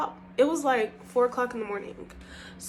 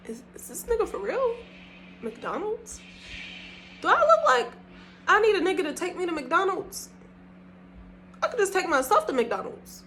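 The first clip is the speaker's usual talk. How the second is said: high-pitched mock disbelief; can't believe what was suggested; incredulous; 7 semitones higher than usual. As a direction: replay the indignation, voice climbing high. Retelling her indignant reaction to date.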